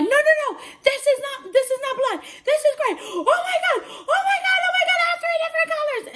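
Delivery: very high, squealing mock panic; freaking out. Client sees gray hair in salon, freaking out. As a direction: shriek it in a retold meltdown. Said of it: "I was freaking out".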